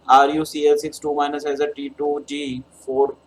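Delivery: usual speaking voice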